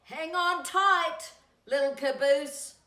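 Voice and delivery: loud, slow call up the mountain. Acted. Flat car calling back to the caboose, loud and slow. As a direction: shout encouragement back down the train. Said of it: "called the flat car".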